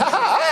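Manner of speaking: high bleats through laughter; laughing goat scream. Retold or imitated. Goat scream after song.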